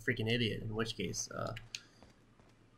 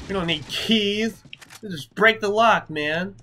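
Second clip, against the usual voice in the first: cocky bravado; 7 semitones higher, 8 dB louder than usual. Acted. Player mocking keys while breaking the lock, loud boasting. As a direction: brag like a tough action hero. Brash hero smashing a lock.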